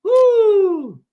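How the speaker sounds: loud high whoop; hype yell. Real, not acted. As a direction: let out a huge victory whoop.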